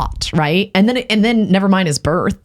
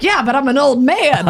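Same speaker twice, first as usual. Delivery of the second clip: higher-pitched, lightly voiced dad; imitated old dad; 7 semitones higher than usual. Dad excusing his chair noises to daughter, imitated old man. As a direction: plead age like a put-upon dad.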